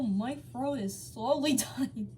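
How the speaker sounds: plain reading voice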